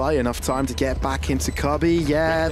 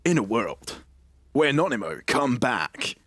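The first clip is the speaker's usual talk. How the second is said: movie trailer voice. Pretend. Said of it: "I can imagine you, like, pitching movies to me".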